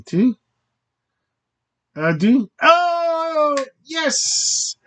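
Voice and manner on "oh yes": high sudden oh yes; pleased surprise. Real, not acted. Vlogger realising he knows adieu, surprised and pleased. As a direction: it just clicked for you.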